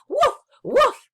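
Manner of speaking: two high, loud yips; excited double woof. Acted. Puppy getting ice cream from the boy, excited double woof. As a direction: yap twice with delight.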